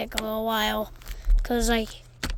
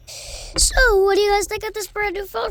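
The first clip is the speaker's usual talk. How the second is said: excited; 10 semitones higher, 8 dB louder than usual. Real, not acted. Vlog presenter hyping the new phone.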